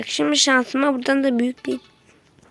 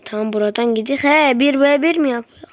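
usual speaking voice

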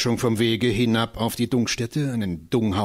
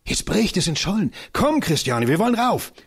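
eager call; 8 semitones higher than usual. Acted. Johannes urges Christiane up the dune, excited. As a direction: pull her along, thrilled. Boy urges her onto ice.

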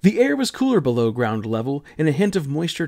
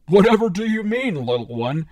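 laughing; 5 semitones higher than usual. Acted. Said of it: "came the laughing response".

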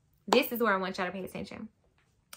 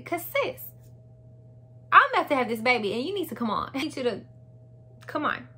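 higher, louder, fast impatient rant at the lost nurse; exasperated; 5 semitones higher, 3 dB louder than usual. Retold or imitated. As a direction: hurry her up, fed up. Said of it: "That made me even more angry".

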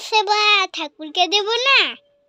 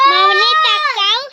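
calling out; 8 semitones higher, 6 dB louder than usual. Real, not acted. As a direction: call out to get her to look. Calling child to look at camera.

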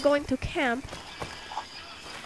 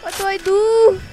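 hammed-up fear; 5 dB louder than usual. Player beset by flying geese, in mock panic. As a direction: play helpless panic, wailing loudly. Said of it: "This is so scary!".

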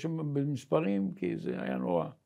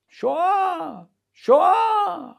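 much higher, louder drawn-out cry; terrible shouting; 11 semitones higher, 7 dB louder, much slower than usual. Retold or imitated. A panicked officer in the war room, shouting. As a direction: yell it in panic, stretching the word. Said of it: "שומע צעקות נוראיות".

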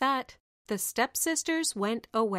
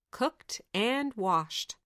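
ordinary delivery

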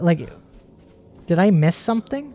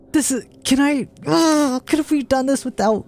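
normal talking voice

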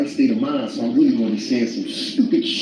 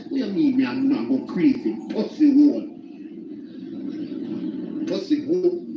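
put-on Jamaican accent. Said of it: "Jamaican argue with a Dominican".

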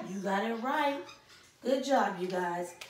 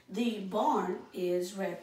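slow read aloud; 2 semitones lower, slower than usual. Shown as a demonstration. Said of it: "I'm gonna read it".

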